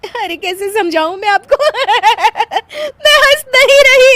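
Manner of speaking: high plea rising to laughing shout; denying while laughing. Acted. Wife trying to explain to husband, laughing helplessly. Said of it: "क्यों हंस रही थी तुम?".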